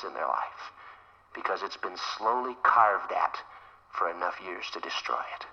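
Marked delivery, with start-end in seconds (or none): none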